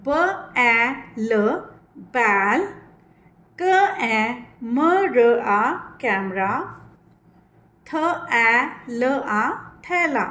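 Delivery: very slow letter-by-letter sounding; slow spelling out. Shown as a demonstration. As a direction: spell each sound deliberately. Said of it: "ध्यान से सुनना है".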